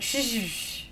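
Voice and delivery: sharp repeated shush; insistent shushing. Retold or imitated. Shushing a coworker who talks during the trailer, insistent. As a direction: shush someone over and over.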